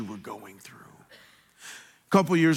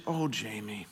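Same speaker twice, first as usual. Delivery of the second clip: tender; 6 semitones lower, 9 dB quieter than usual. Retold or imitated. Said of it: "she was always the tender one".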